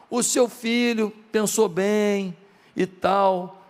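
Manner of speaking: usual speaking voice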